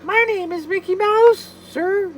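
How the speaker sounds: very high falsetto mouse voice; terrible Mickey Mouse. Acted. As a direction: squeak it out like a cartoon mouse. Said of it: "I do a terrible Mickey".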